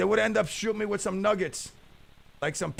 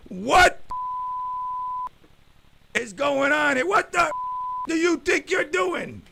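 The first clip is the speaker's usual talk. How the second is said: loud, higher angry cop; imitated cop yelling; 11 semitones higher, 6 dB louder than usual. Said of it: "when you go".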